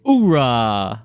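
barked military cheer, loud; loud gung-ho soldier. Acted. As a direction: shout it like a marine. Reading soldier answer aloud.

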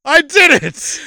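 loud, high cheer; triumphant. Real, not acted. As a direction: crow about the win. Celebrating his fake IMDB credit.

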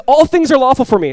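high, loud proclamation; Paul quoting a slogan, loud and high; preached slogan. Acted. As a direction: call out the slogan to the room. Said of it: "He says".

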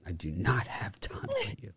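cold, dismissive brush-off; Inventing Anna voice. Pretend. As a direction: deliver it like an icy heroine. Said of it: "saying it with her voice".

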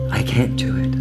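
whispering. Acted. Client confessing in whisper.

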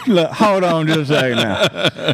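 put-on country boy voice. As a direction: play a cocky country boy stringing the caller along. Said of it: "I'm gonna be like".

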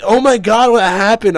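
high, loud mock-girly gushing; hammed-up high-pitched excited girls. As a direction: imitate a gaggle of girls gasping the question. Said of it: "Like, a bunch of girls".

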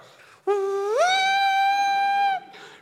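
long, high, loud horn hoot; imitated shofar blast. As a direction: sound a sustained ram's-horn call. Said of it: "[name]'s on the shofar".

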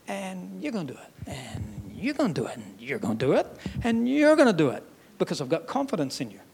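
hushed, repeated encouragement; quiet confidence. Acted. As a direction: murmur it like a steady vote of confidence. Jesus voicing trust in Peter.

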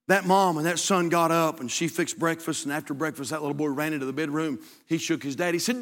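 ordinary delivery

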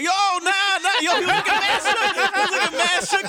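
high voice breaking into laughter; laughing high. Real, not acted. Host laughing as he describes the shook guy. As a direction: tell it while cracking up.

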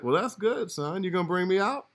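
hint for a free trip; sly dad. Retold or imitated. Dad teasing his son about the big check, sly. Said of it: "He was like".

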